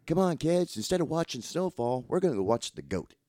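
hammed-up dad rallying the kids; mock parent voice. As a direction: sell the goat trip like a corny dad.

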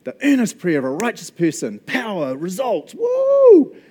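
mock excited cheering. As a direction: cheer the verse like an overexcited fan. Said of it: "And we go".

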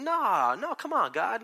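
a gentle whiny objection, not a yell; mild mock protest. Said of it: "he's not saying".

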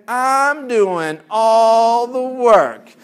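high, loud, very drawn-out wail; martyred complaint. Acted. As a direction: draw out every word in mock outrage. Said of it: "Have you ever said that statement?".

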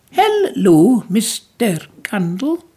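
put-on a woman with a child's delight says hello; childlike Belle. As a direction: greet him like an eager child. Belle greets McCandless.

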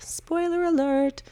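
a cheeky heads-up; playful spoiler warning. Acted. Warning viewers before ruining the movie, a high teasing singsong.

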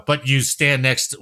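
normal talking voice

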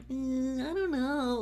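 high, soft reluctant hum; a reluctant, high "I don't know"; hesitant doubt. Retold or imitated. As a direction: hedge as if unsure. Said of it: "that you're like".

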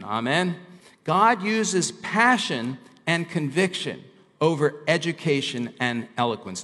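normal talking voice

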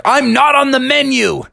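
high-pitched cartoon objection; high, affronted retort; indignant protest. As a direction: object loftily, pitch jumping up. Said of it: "And Bubby was like".

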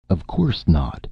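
ordinary delivery, nothing marked

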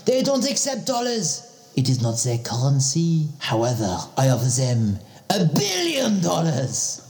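put-on French accent growing excited; a boastful Frenchman bragging about bribes; comic French accent. Same French-accented character.

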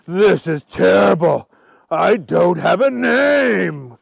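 slow dragon wail; crying out. A narrator's reading. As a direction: wail it, stretching the last word. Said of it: "cried the dragon".

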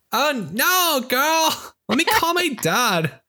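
put-on a rich girl threatening with daddy; Valley Girl voice.

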